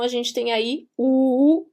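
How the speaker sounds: ordinary talk, nothing marked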